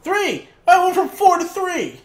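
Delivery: high, loud shocked exclamation; excited shout. Real, not acted. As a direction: blurt it out in disbelief. Follower count drops during recording.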